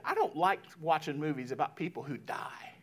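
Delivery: put-on hushed, partly whispered kid's confession; imitated quiet child. Child tells parent about movies with death, hushed imitation. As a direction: softly imitate a child admitting a dislike. Retold words of child Eden.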